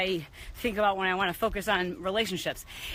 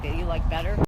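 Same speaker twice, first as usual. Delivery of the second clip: calming; 2 semitones lower than usual. Real, not acted. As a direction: tell them gently to settle. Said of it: "otherwise known as simmer down".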